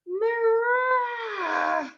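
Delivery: grumpy monster growl held long; monster grumble. Acted. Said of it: "Frankenstein would say".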